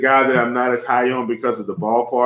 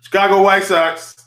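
fast, high, loud burst of passion; excited, punchy shout-out; passionate; 7 semitones higher, 5 dB louder than usual. Real, not acted. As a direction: fire it out with passion, quick and loud. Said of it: "Said that with passion, and he said it fast".

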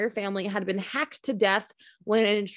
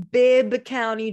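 Bibb County drawn out slow and louder, leaning on Bibb; savoring the name; 4 semitones higher, 7 dB louder, slower than usual. Real, not acted. Host savors the odd name Bibb, slow and louder. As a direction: relish saying Bibb County slowly. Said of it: "I just wanted to say Bibb".

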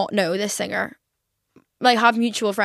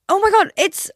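excited gasp of recognition; 10 semitones higher, 4 dB louder than usual. Retold or imitated. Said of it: "my initial reaction went".

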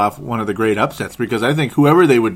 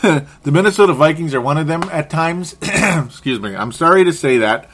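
ordinary talk, nothing marked

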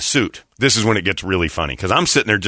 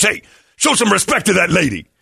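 high, indignant mock command; stern rebuke; 8 semitones higher than usual. Big guy steps in to defend the lady, indignant. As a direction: imitate a man stepping in to scold. Big man intervenes in retold quarrel.

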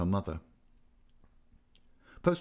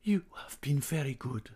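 whispered, higher and tremulous; shaking voice; 5 semitones higher than usual. A narrator's reading. Singer thanking the young man, voice shaking to a whisper. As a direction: let it come out as a shaky whisper. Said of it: "her voice shook".

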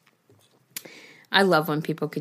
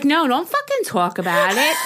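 higher-pitched, embarrassed brush-off; mock exasperated refusal; 6 semitones higher than usual. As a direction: shut the subject down, half-joking. Acted refusal to partner at breakfast.

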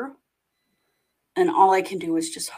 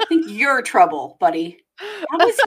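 laughing; 6 semitones higher, 6 dB louder than usual. Real, not acted. Teasing the smuggler, laughing.

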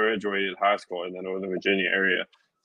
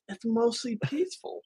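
much higher sarcastic parody of a news line; mocking the media's line in a much higher voice; 12 semitones higher, 5 dB quieter than usual. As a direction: sneer it up high like a mock anchor.

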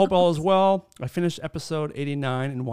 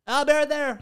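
a light higher put-on phone voice; phone guy; 11 semitones higher than usual. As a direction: brush off the caller mid-stop. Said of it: "I'm the guy who's like".

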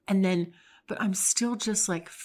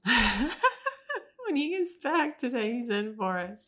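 laughing; 5 semitones higher, slower than usual. Real, not acted. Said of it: "I'm sort of kicking my leg a little nervously".

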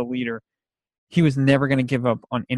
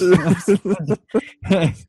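high, loud laugh at himself; embarrassed laugh; 6 semitones higher, 5 dB louder than usual. Real, not acted. Recalling his tears at the film, a high embarrassed laugh. Laughing about crying at movie.

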